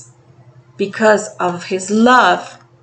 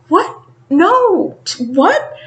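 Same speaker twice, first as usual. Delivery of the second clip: higher, rising mock shock; shocked denial; 8 semitones higher, much slower than usual. Widow hearing the news, mock shocked denial. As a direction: imitate a stunned widow's protest. Wife hears bad news.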